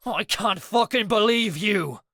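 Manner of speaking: high, loud furious roar; furious yell. A narrator's reading. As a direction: shout it in a rage at a friend. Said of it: "Danny growled".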